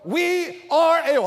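high, loud "We are able"; confident. Shown as a demonstration. Preacher offering the confident choice, loud. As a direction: say it with conviction. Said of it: "are we able?".

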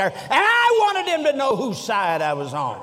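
high, ringing proclamation, falling away; emphatic declaration. Retold or imitated. Doctor Lee explaining himself to the preacher, emphatic. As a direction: launch high on the first words and descend.

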